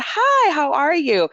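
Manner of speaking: high, drawn-out sing-out hello; put-on cheery greeting; over-friendly greeting. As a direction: greet them sweetly, stretching it out high. Greeting her college bully in anecdote.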